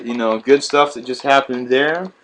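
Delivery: normal talking voice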